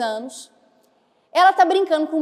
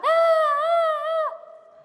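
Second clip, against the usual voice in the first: high fake child wail, fading out; mock child crying; 14 semitones higher, much slower than usual. A teacher mimics a small boy wailing. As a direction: wail like a small boy whose toy broke.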